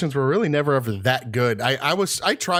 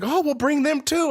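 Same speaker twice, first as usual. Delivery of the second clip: high, slow salesman voice; eager salesman. Retold or imitated. Salesman told about the twins, mock eagerness. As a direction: brush off the objection with mock eagerness.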